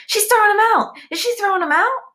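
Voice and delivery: put-on high, loud eager kid voices; excited gossip. A narrator's reading. Classmates thrilled at the drama, excited. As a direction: gasp it out like a thrilled pupil. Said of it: "He hears excited voices".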